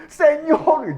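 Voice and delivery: high-pitched call to heaven; anguished cry. Acted. Brother in the ambulance calling to God, anguished cry. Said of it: "y ahí gritaba por dentro".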